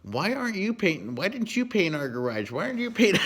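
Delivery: high, loud mock whine of complaining wives; nagging wives. Wives nagging husbands about painting, shrill mock whine. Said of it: "all their wives are bitching at him".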